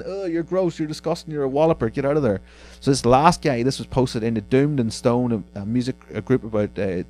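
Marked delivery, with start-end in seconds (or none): 0.0-2.4 a higher, mock-disgusted telling-off. Real, not acted. Host telling off a creepy reply guy, disgusted. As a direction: push the creep away with disgust.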